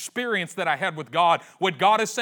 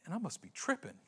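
puzzled mutter; 3 semitones higher, 11 dB quieter than usual. Acted. Samuel muttering to himself.

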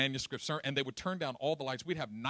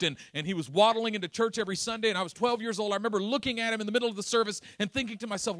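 high, loud fast storytelling preacher; full-force preaching; 7 semitones higher, 8 dB louder than usual. Acted. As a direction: drive the story with rising fervor.

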